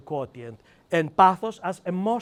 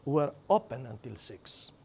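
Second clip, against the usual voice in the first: "open" said soft and lower, friendly; right way; 6 semitones lower, 7 dB quieter than usual. Shown as a demonstration. Shop owner answering a customer the positive way. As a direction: ease "open" out softly and low. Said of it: "practical examples of the new mentality".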